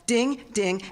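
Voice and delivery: ordinary delivery, nothing marked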